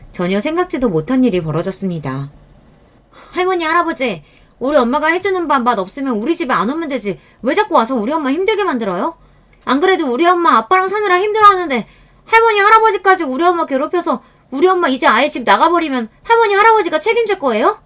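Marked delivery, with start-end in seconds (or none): 3.3-4.2 put-on higher, upset girl addressing grandparents; a teenage daughter confronting her grandparents; angry daughter calling out. A narrator's reading. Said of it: "큰애가 나서서 시부모님께 소리를 지르더라고요". 4.6-17.8 put-on a girl shouting to defend her mother; angry teen yelling. A narrator's reading. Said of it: "큰애가 나서서 시부모님께 소리를 지르더라고요".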